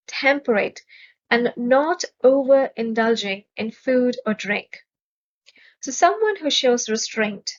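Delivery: ordinary talk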